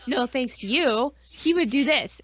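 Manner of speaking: ordinary delivery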